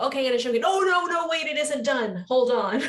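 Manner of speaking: mock panic, no wait, not ready yet; panicked backpedal. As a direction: suddenly catch yourself showing unfinished work. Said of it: "You go".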